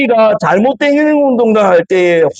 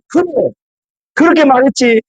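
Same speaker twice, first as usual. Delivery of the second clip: higher, quick indignant rebuke; angry rebuke; 5 semitones higher than usual. Retold or imitated. God angrily answering the grumbling Israelites, indignant and quick. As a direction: voice God fed up with grumbling people. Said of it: "막 이렇게 화내는 장면이에요".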